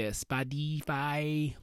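SPOTIFY drawn out slowly, syllable by syllable; playful mispronunciation. Shown as a demonstration. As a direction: stretch Spotify out as a pet pronunciation. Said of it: "as I like to say".

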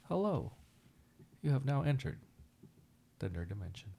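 soft automated ship announcer, falling away; pleasant sci-fi landing voice. Acted. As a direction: pleasant spaceship arrival announcement. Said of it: "that really pleasant voice when you're, like, landing".

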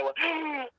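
a sudden high gasp for air, reliving it; sharp gasp. Retold or imitated. Reliving waking up on the rock, sharp gasp. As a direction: gasp as if breath just came back. Coming back after passing out.

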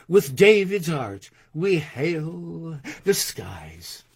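grand declaimed verse with drawn-out words; poem recitation. Acted. Poet answers scoffers in verse before an audience, declamatory recitation. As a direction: proclaim it, stretching the key words. Reads own poem aloud.

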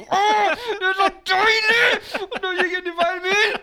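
high, loud laughing exclamation through tears; laughing old man. Retold or imitated. Old man laughing at himself in the wrong car, high and loud. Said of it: "han sitter og ler så han griner".

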